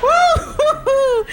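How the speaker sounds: high, slow laughing hoot; slow high whooping laugh. Real, not acted. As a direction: hoot it out like a celebration. Laughing at own joke.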